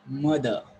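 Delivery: mother, said MUH-thuh with no final R; silent R. Shown as a demonstration. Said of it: "we are not pronouncing it clearly".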